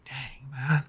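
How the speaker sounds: hushed, let-down murmur; deflated near-whisper. Retold or imitated. Man retelling his reaction to wife's doubt, deflated whisper. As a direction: say it under your breath, deflated.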